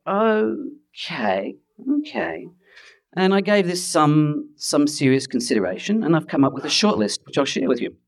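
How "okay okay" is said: doubtful acceptance. Retold or imitated. Wary reply to recruiters' suggestion.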